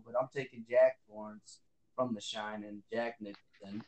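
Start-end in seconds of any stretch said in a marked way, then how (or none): none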